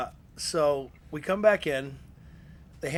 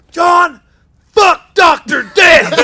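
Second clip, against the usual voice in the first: very loud, much higher, slow yell; defiant shout; 12 semitones higher, 16 dB louder, much slower than usual. Retold or imitated. Ray screaming at the boss in defiance, full shout. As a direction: scream it right back at the boss.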